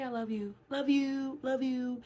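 mock breezy goodbye love yous; gushing love yous. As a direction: toss out love you love you breezily. Mimicking careless farewell love yous.

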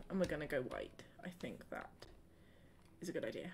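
soft, low whisper; whispering her decision while building. Real, not acted. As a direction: whisper the plan to yourself.

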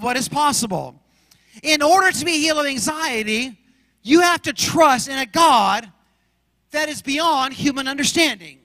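full preacher's cadence, rising and falling; preaching. Acted. Pastor preaching to his church about anxiety, fervent. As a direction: preach it to the congregation with conviction.

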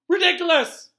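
high, loud squeak; high-pitched squeaky shout; squeaked spell. A narrator's reading. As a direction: squeak it out like a startled cast. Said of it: "squeaked Neville".

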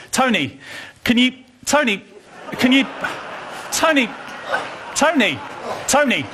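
host calling a stalling guest again and again. Acted. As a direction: keep calling him from down the corridor. Calling Tony repeatedly.

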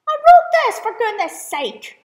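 high, loud exasperation; exasperated outburst. Real, not acted. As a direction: vent at yourself. Annoyed at own mistake.

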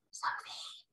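whispered, anxious call of Sophie. Acted. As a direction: hush it, checking she's safe. BFG calls quietly after danger.

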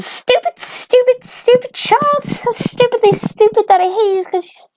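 very high, loud, rapid-fire tirade; shrieky cartoon mom, hammering every insult; hateful mom rant. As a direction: rant on and on in a squeaky put-on voice. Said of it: "her mom is still going".

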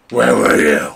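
hammed-up furious demand; Batman shout. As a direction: yell it like an angry caped hero. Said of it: "Talking in my Batman voice".

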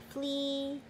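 usual speaking voice

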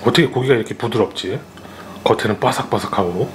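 words muffled by food; talking while chewing. Real, not acted. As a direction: mouth partly full of meat. Seen 0: Ate meat just before; lips and cheeks move like chewing while talking.

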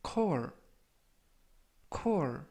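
core said as "kor"; pronunciation demo. Shown as a demonstration. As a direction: model core for learners to repeat. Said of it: "core이라고 발음하시면 되겠습니다". (발음 시범)

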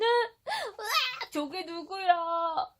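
high drawn-out shriek of shock; shocked scream. Acted. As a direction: recoil from the mirror. Prince shocked at mirror. (깜짝 놀란 비명)

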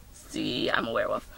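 very soft, low whispery murmur; low mutter. Acted. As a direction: mutter it low under the breath. Muttered insistence after throat clearing.

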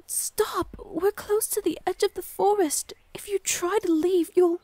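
high, loud, urgent calls to stop; urgent shout. Acted. Forest guardian stopping a lost soul from leaving, urgent shout. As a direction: call out sharply to stop them at the edge.

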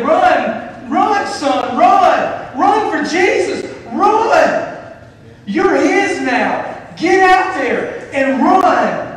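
high, loud rally yell, last lines slow; cheering shout. Pretend. Preacher urges church to cheer new believer, booming shout. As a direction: roar it like a crowd urging a runner home. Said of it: "We should cheer and say".

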